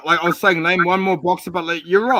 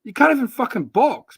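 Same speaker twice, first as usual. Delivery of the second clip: higher, louder incredulous rant; outraged rant at the Aussie chat; heated outburst; 8 semitones higher, 4 dB louder than usual. Real, not acted. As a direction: blow up at him in disbelief. Heated argument over boxers.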